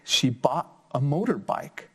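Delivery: put-on lively, sing-song story reading for kids; reading to children. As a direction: bring the picture book alive for little listeners. Reads picture book aloud.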